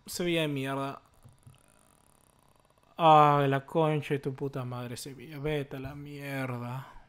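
soft bitter curses; soft dejected cursing; muttered curses. Real, not acted. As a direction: curse under your breath. Muttered curses after losing.

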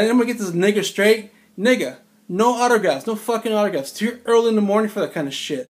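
imitating Jordan snapping, heated and loud; retold angry yell. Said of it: "Michael Jordan's, like, yelling at me".

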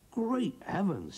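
drawn-out astonished outburst; shocked exclamation. Acted. Farmer Giles seeing the huge goose walk in, shocked.

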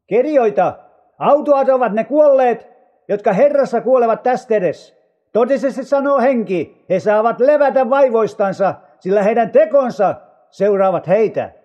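heavenly voice. A narrator's reading. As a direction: proclaim it from on high. (korotetulla äänellä julistaen)

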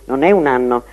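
ordinary talk

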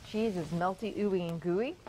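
ordinary talk

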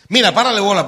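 loud eager look-here; excited pointing out. Retold or imitated. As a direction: grab his attention fast. Urgent pointing out to friend.

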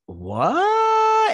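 very high, loud, drawn-out shriek; exaggerated cry of disbelief; mock shock. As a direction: squeal it in comic astonishment. Acting out her shocked reaction.

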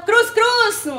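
high, loud sing-song blessing; playful blessing. Real, not acted. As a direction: bless it with a cheeky cry. Blessing the dough. (bendición cantada)

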